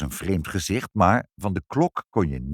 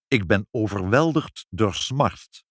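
plain reading voice

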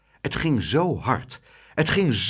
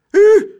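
very high, loud frightened whoop; yelped squeal of fright; sudden high shriek; startled cry; 14 dB louder than usual. A narrator's reading. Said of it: "riep juffrouw Klaterhoen".

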